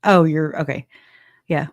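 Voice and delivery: usual speaking voice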